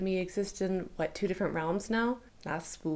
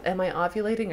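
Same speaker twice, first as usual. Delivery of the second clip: ordinary delivery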